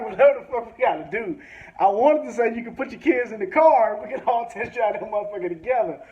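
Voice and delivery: excited outburst. Retold or imitated. Comedian to the mechanic about test driving, excitedly loud. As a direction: pitch up and push it out fast. Eager to test drive together.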